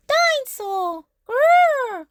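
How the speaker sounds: put-on high toddler roar, loud growl; shouted dinosaur growl. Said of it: "roared George".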